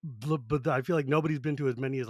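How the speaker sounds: normal talking voice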